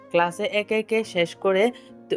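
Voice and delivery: plain reading voice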